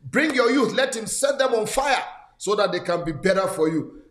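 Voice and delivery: ordinary talk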